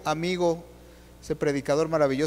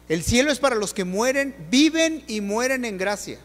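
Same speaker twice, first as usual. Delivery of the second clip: normal talking voice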